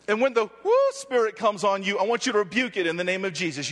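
usual speaking voice

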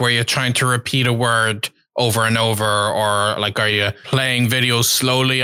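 ordinary delivery

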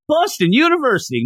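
put-on high, eager college kid voice; timid player answer. Player answering the coach, high and eager. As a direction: pipe up like a nervous recruit.

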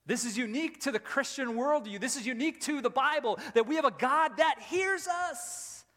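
preacher's ringing declaration; impassioned preaching. Real, not acted. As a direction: proclaim it with building force.

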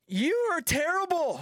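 high, slow, whining accusation; drawn-out high protest; mock player complaint. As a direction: whine it at the umpire. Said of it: "they're like".

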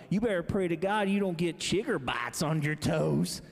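put-on lightly done Ozark country accent; a hint of the Ozark boss warning. Parking boss warning about chiggers, light Ozark accent. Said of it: "he's got this really thick accent from the Ozarks of Missouri".

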